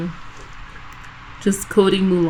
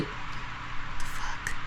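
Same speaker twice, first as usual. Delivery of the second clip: breathy whisper of disbelief; whispered the fuck; 15 dB quieter than usual. Retold or imitated. Coworker whispering in disbelief at the drive-thru. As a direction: hush it like a coworker aside. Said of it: "she was just like".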